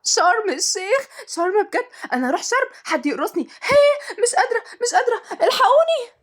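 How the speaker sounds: high-pitched, giddy squealing; ecstatic, can't-contain-it shrieks; jumping for joy. A narrator's reading. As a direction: squeal with overwhelming delight. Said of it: "وقامت تتنطط بفرحة".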